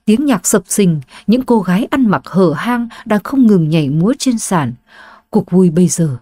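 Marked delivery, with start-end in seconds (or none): none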